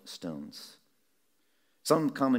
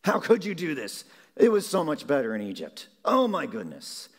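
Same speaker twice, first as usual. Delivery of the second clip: higher, louder whiny complaint, lightly voiced; grumbling Israelites; 5 semitones higher, 5 dB louder than usual. The thirsty people grumbling at Moses, in a whiny complaining voice. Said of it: "they would grumble and complain and say".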